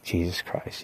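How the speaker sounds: sick, whispered Jesus Christ; a soft whispered groan of disgust; whispered oath. Retold or imitated. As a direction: breathe it out in disgust. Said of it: "I'm like".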